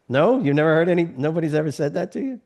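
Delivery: ordinary talk, nothing marked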